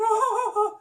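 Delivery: nervous cartoon titter; high anxious snicker; Shaggy nervous laugh. Shown as a demonstration. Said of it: "Shaggy's laugh or his nervous laughter".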